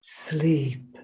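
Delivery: very soft voiced sleep; faint hiss. A narrator's reading. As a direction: let the sleep die away. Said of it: "she hissed".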